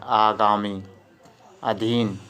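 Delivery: ordinary talk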